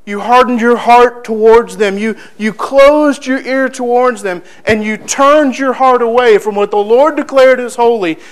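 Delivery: loud, forceful prophetic accusation; accusing declaration. Acted. Preacher as Stephen declaring to the high priest, accusing. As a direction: drive each charge home at the leaders. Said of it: "how Stephen is declaring to the high priest".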